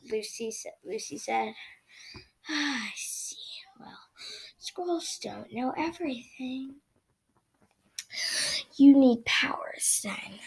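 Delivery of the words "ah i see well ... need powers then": slow, tired whisper; whispering tree. Acted. Wounded Hearth Star whispering to the children. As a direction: whisper it wearily. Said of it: "whispering vibe".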